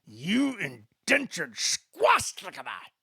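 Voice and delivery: put-on a furious drunk insulting the narrator. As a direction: spit the insult out in fury. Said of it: "Graz grew more furious".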